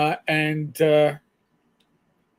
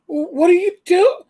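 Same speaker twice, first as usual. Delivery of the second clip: high, loud mock-shocked mother; imitating shocked mother; 13 semitones higher, 9 dB louder than usual. Son imitating his mother scolding him at dinner, high shocked voice. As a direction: squeak it out like a scandalized mom.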